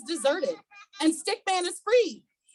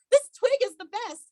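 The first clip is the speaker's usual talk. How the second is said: swan voice; 7 semitones higher, 4 dB louder, faster than usual. Acted. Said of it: "says the swan".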